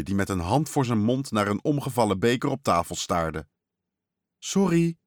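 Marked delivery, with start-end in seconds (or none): 4.4-5.0 small, high-pitched sorry; mildly faltering little brother; stammered apology. A narrator's reading. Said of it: "stamelde Thijs".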